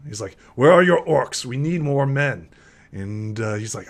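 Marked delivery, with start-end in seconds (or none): 0.6-2.5 loud, raised-pitch demand; urgent demand. Acted. Commander demands the missing orcs, loud and urgent. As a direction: demand answers in mock alarm, voice up.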